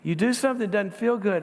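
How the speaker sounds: ordinary talk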